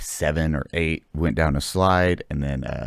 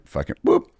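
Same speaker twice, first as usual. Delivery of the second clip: gleeful whoop. Retold or imitated. Gleeful sound effect.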